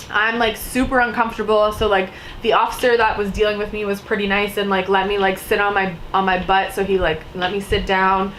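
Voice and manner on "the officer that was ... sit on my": normal talking voice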